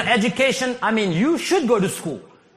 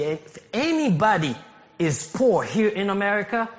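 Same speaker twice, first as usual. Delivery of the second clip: ordinary talk, nothing marked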